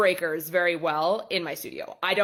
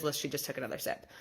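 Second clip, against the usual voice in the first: hushed aside; 4 semitones lower, 9 dB quieter, faster than usual. Retold or imitated. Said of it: "so she didn't hear".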